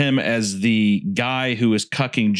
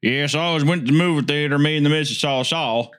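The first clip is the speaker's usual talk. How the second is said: put-on higher, playful American accent; hammed-up American accent; 4 semitones higher than usual. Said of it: "just my good American accent".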